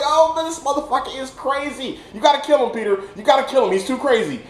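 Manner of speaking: high, agitated mock panic; worked-up, high-pitched pleading; alarmed reaction. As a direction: urge the hero on, half laughing. Acts out his alarmed viewer reaction.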